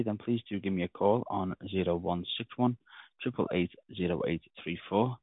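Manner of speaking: ordinary talk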